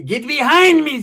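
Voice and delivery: loud, high rebuke; hammed-up banishing cry; mock exorcism shout. Storyteller wards off Satan, hammed-up shout.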